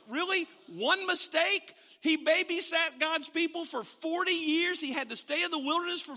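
rising outrage on their behalf; indignant outburst. Real, not acted. Preacher protests unfairness.

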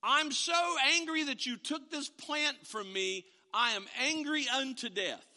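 high, loud sulking anger; angry Jonah. Retold or imitated. Jonah restating his anger to God, loud. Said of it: "that's Jonah's answer".

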